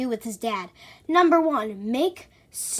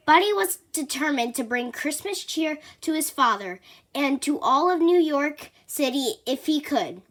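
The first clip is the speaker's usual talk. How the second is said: usual speaking voice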